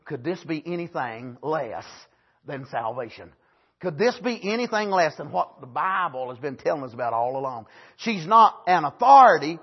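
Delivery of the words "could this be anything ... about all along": softer, lower, awed question; wondering quietly. Retold or imitated. Woman telling the townsmen, softly awed. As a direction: marvel at it under your breath. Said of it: "She was saying".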